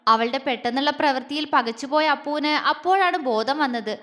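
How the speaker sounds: plain reading voice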